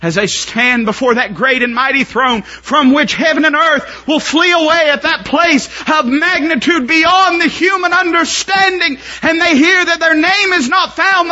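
high, loud shouted preaching; preaching at full force. Acted. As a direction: drive it home shouting.

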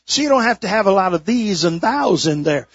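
ordinary talk, nothing marked

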